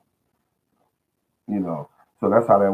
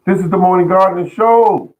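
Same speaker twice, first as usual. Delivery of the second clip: higher, louder announcer sign-off; show announcer; 9 semitones higher, 6 dB louder than usual. Acted. Host signs off the gardening show, announcer flourish.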